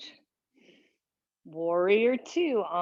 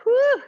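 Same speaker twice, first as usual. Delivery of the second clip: loud, high "woo"; short high whoop from exertion; effortful whoop; 9 dB louder than usual. Real, not acted. Exertion during exercise count.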